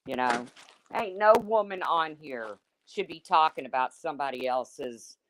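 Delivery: normal talking voice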